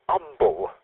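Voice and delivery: "umble" starting straight on the vowel; umble without H. Shown as a demonstration. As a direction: say "umble" with no H sound. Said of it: "without the H".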